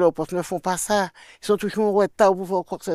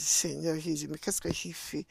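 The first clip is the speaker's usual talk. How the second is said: quiet worried prayer; 3 semitones lower, 10 dB quieter than usual. Retold or imitated. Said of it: "Je dis".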